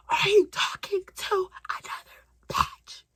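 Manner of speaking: high, loud drawn-out screech; screaming and yelling. Retold or imitated. Girlfriend confronting him on the phone, screaming. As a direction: scream each word slowly in outrage. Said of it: "I'm screaming and yelling for no reason".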